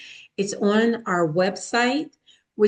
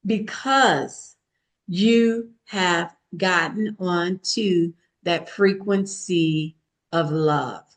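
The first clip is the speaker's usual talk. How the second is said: normal talking voice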